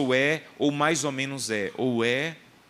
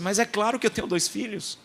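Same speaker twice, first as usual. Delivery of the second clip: insistent mother; 10 semitones higher than usual. Retold or imitated. Mother insisting to fortune teller.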